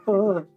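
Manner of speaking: high, thin gasp of alarm; startled high elf gasp. Acted. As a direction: squeak in fright. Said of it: "kinda like".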